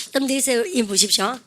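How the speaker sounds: usual speaking voice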